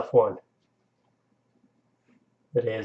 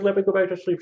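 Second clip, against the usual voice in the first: lightly voiced dog, higher than usual; dog's voice; 7 semitones higher, faster than usual. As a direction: speak lightly as the sleepy dog, pitched up. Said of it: "She's like".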